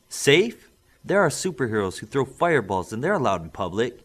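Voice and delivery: a mother bristling at being told no; indignant mother. Acted. Mother protesting to the official, loud and indignant.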